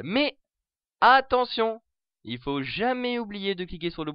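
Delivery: high, loud cry of caution; emphatic warning. Real, not acted. Warns viewers about the red button.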